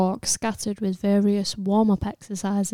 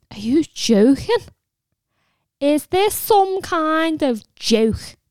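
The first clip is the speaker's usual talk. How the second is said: high, loud, slowed panicked protest; panicked shriek; 8 semitones higher, 5 dB louder, slower than usual. A narrator's reading. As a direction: recoil in terror at the dragon. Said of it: "shriek of protest came out as a whimper".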